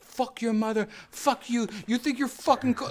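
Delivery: high-pitched imitation of a furious scammer; mad scammer. Host mimics a scammer losing it on video, mock-furious. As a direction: rant in a high mock-angry voice. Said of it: "this scammer getting so mad".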